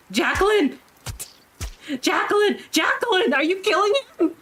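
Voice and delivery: high-pitched cries of alarm, acted out; alarmed shouting. Character calls out to Jacqueline mid-killing, alarmed high shout.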